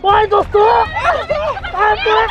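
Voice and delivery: high, loud panicked yelling; a man being sucked into the sand, yelling in panic; panicked shout. Acted. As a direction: scream for them to pull you out. Sinking, panicking.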